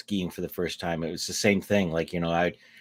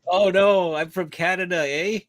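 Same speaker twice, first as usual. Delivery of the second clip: put-on Canadian accent; 9 semitones higher, 6 dB louder than usual. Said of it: "Listen to that Canadian accent".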